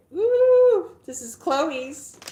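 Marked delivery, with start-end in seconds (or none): 0.1-0.9 high, loud, drawn-out woo; excited woo. Real, not acted. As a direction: whoop with delight, stretched slow. Excited about dog treats.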